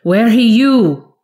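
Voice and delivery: rising to a loud, high panicked cry; desperate scream. A narrator's reading. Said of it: "he screamed desperately".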